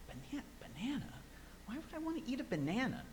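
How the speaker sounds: high, quick, incredulous mock question; retold bewilderment, pitched right up; puzzled disbelief. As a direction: act out someone baffled by odd advice. Retelling Bill's puzzled reply to advice.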